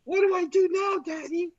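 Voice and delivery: put-on high, small toddler voice; little kid voice. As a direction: ask like a lost little child. Childlike voice playing toddler.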